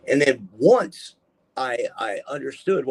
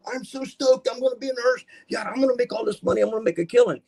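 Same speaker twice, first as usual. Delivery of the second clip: put-on high, eager young voice; excited; 12 semitones higher, faster than usual. Young self bragging to an old schoolmate, high and excited. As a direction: gush about a future nursing paycheck. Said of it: "And I'm like".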